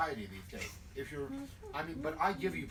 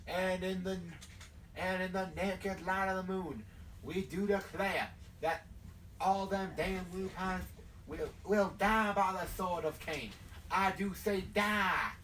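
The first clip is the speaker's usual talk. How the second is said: southern preacher. Acted. Leader vowing death to the lupines, southern preaching. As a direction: declare it like a southern revivalist. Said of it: "In that accent?".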